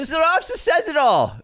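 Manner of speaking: loud, high-pitched outburst; exasperated protest. Real, not acted. Host defending himself over spoiler complaints, loud exasperated protest.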